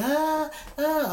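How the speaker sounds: high-pitched mock holler; imitated hollering. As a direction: wail out like someone in the water. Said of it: "she hollering".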